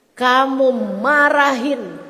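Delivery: ordinary talk, nothing marked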